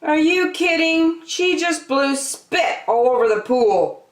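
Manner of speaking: put-on high outraged boy's cry; shouting boy. A narrator's reading. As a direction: yell it like a disgusted little brother. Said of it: "he shouted".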